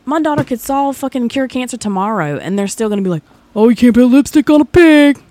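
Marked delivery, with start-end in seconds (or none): 3.6-5.2 high, loud snooty put-down voice; loud mocking impression of a snide relative. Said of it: "Who does that sound like".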